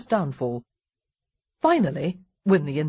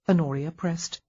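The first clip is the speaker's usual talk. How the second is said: ordinary delivery, nothing marked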